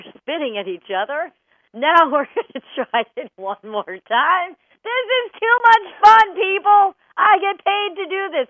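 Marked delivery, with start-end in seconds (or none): 4.8-8.5 high, loud, gleeful outburst; thrilled and loud, pitched way up; real delight. Real, not acted. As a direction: burst out having great fun. Delighted mid-exercise outburst.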